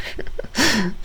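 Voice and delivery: a short squeaky giggle; high giggle. Real, not acted. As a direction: burst out in a high-pitched laugh. Laughing at the meme comments.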